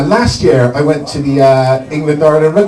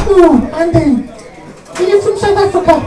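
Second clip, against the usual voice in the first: Tatiana. Acted. Date guesses again at his homeland, in mock falsetto. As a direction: guess in a put-on squeaky woman's voice. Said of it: "So she goes".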